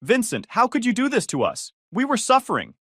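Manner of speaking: high, loud shriek of outrage. Acted. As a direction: shriek it like her comfort machine just got unplugged. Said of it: "she started shrieking".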